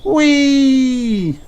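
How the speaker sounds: very high delighted squeal; child leaping into the air; joyful whee. A narrator's reading. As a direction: squeal mid-jump. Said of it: "jumping high".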